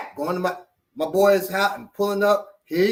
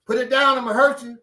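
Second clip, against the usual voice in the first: higher, louder forceful warning; threatening command; 7 semitones higher, 5 dB louder than usual. Acted. Warning someone holding an open knife, threatening command. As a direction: warn them loudly and firmly.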